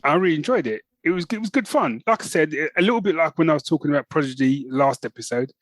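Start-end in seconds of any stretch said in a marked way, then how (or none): none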